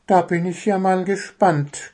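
ordinary delivery, nothing marked

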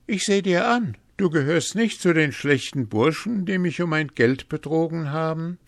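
high, animated character voice; bold young hero. A narrator's reading. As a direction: speak up boldly to a stranger. Hans addresses the mysterious man.

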